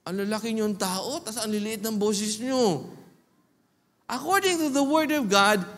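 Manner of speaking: ordinary talk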